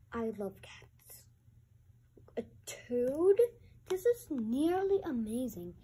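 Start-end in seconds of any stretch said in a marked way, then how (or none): 0.1-1.2 whispered. Real, not acted. Child whispers answer.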